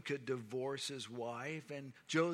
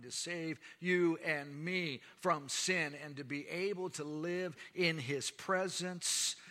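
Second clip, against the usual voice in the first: louder, higher and slow, building to a climax; full-force preaching; 4 semitones higher, 6 dB louder than usual. Acted. Preacher proclaims salvation to the congregation at full force. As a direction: preach it out, swelling with conviction.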